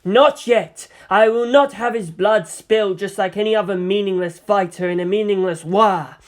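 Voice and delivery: imperious woman's passionate rebuke; commanding seer. Acted. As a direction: silence the rabble with fierce authority. Farseer rebukes the quarrelling figurines.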